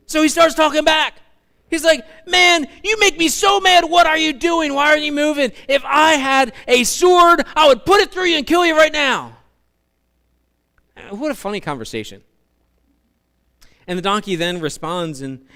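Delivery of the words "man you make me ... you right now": high, loud ranting threat; angry Balaam. Acted. Preacher voicing Balaam threatening his donkey, high loud angry rant. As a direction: rant at the donkey, higher and louder.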